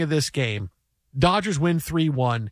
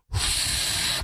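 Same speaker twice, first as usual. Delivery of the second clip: frustrated exhale; 5 dB quieter than usual. Retold or imitated. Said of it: "he blows air out".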